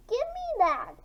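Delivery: high yell; snatch it back with a yell; shout. Acted. Mara yelling at Greg to give back her book.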